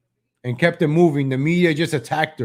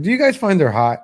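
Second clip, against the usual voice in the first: normal talking voice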